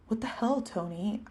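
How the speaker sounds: ordinary delivery, nothing marked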